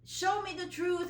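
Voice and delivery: loud, high demand to God; angry demand at God. Retold or imitated. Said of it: "screaming at God".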